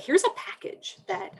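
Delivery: high, breathy put-on voice like a pitch; mock sales pitch. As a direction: play a cheery provider offering a package. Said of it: "that can be useful to be able to say, like".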